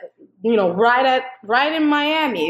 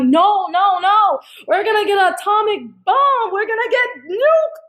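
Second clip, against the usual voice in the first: insistent protest; 8 semitones higher than usual. Retold or imitated. Arguing with a doubting girl.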